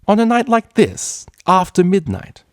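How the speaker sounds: mock his excuse with disbelief; scornful outburst. Mare scoffing at the stallion's excuse, high and loud.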